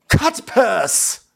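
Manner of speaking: high, punched-out "cut purse"; hammed-up insult. Envoy insulting the prince, hammed-up disdain. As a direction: deliver it high as a grand punchline.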